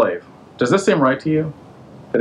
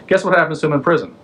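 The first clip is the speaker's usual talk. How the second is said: ordinary delivery, nothing marked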